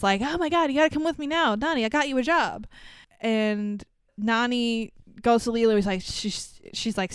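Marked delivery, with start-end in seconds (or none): 0.2-2.7 hurried, urgent retelling; fast and slightly raised, hurrying someone along; rushed. Retold or imitated. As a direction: rush in with breathless news to deliver. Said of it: "very rushedly".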